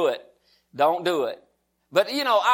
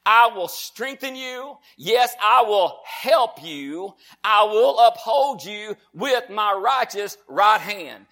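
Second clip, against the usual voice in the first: slow, loud preacher's declaration; emphatic pulpit delivery; declaimed scripture; 3 semitones higher, 4 dB louder, much slower than usual. Acted. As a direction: proclaim each phrase with stretched, weighty words.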